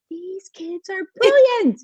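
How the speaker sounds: high, slow, amazed delight. Retold or imitated. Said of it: "I was like".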